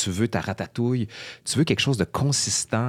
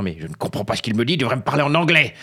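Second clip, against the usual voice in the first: quick mock outrage, climbing louder and higher; insulting grandmother; 4 semitones higher, 4 dB louder, faster than usual. French grandmother belittling her Québécois grandson's accent, mock-insulting. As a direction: imitate an indignant grandmother, rising to a loud complaint. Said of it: "l'insultant, quasiment le giflant en disant".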